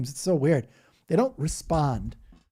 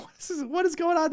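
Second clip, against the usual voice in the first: much higher voice asking in shock; baffled disbelief; faster than usual. Retold or imitated. Retelling shock at the church, much higher. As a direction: act totally bewildered. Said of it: "I was like".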